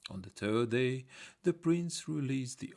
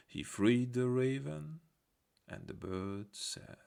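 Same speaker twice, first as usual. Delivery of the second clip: ordinary delivery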